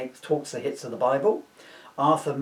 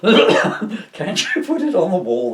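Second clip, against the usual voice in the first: mock label boss; 6 semitones higher, 9 dB louder, slower than usual. Label bosses voiced in story.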